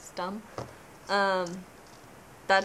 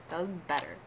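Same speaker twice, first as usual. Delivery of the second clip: ordinary talk, nothing marked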